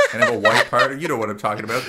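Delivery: ordinary talk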